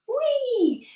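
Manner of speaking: high, gleeful whee; playful whee. Pretend. Teacher spinning with the kids, high whee. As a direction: squeal as you spin.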